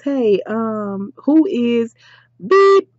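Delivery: ordinary talk, nothing marked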